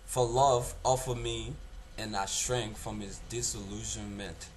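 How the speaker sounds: loud, declaimed verse with long weighted pauses; dramatic monologue. Acted. Young actor performing a George Gray monologue for an audition, loud dramatic declamation. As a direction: declaim it with force, phrase by phrase.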